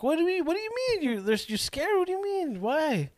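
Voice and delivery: high, quick retold disbelief; incredulous questioning. Driver asking passenger why she's scared, high-pitched disbelief.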